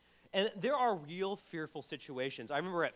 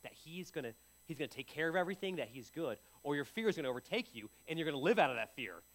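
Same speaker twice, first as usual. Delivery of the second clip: usual speaking voice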